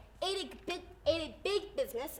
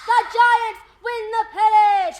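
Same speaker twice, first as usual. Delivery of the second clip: much higher and louder, yelling the home run; ecstatic play-by-play call; 9 semitones higher, 15 dB louder than usual. Retold or imitated. Retelling the 1951 pennant-winning homer as an ecstatic, much louder, higher announcer call. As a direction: scream it like the radio call of the century. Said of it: "the shot around the world".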